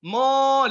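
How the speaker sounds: mo with a heavy, rounded mouth; thick mo. Shown as a demonstration. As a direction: round the lips hard on mo. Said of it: "Tapi kalau tebal".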